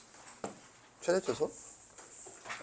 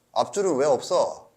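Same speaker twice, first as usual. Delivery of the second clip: ordinary talk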